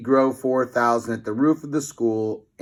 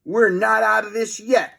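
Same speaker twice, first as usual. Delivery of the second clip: yell; 9 semitones higher, 3 dB louder than usual. A narrator's reading. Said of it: "yelled Fluffy".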